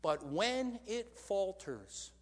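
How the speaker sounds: "when" drawn out slowly and pressed; slow emphasis on when. Real, not acted. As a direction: lean hard into "when", very slow. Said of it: "not if".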